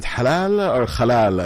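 puzzled toggle between both "halal" sounds; two pronunciations of halal. Retold or imitated. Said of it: "Which one is it?".

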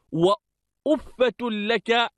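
وأفة said "wa-uffatun", opening on u; damma, added ha. Shown as a demonstration. Teacher showing students وأفة as "wa-uffatun", u on the alif. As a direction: give وأفة a u start and "-fatun" ending. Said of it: "بضم الألف".

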